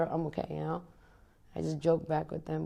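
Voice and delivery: ordinary talk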